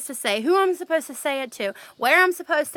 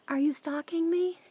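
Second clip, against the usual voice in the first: imitating her whispered joke; hushed, teasing whisper; whispered quote; 3 semitones higher, 7 dB quieter than usual. Coworker whispering a joke to the whistling narrator, hushed. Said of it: "she turns around, and she says".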